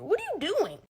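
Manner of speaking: high-pitched incredulous demand; comic outburst, voice jumping up; exasperated scolding. Pretend. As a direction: scold them in disbelief. Scolding the video makers.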